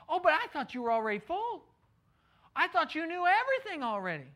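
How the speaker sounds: high, hammed-up mock surprise; over-the-top sarcasm. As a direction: overplay the fake surprise. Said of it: "I know I'm being over the top".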